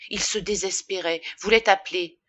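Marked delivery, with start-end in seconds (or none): none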